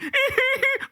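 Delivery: short shrill mock laugh; mocking high laugh. Host mocking a Republican, high mock laugh. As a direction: cackle like a bratty cheater.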